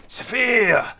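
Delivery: one word in a hammed-up German accent; angry German. Presenter playing with a word from the article, angry German. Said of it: "Sound like an angry German".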